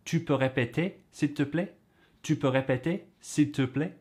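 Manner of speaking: tu peux répéter said with the voice going up at the end; rising question tune. Shown as a demonstration. Teacher showing learners how a rising voice makes a question. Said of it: "you go up at the end and it makes it a question".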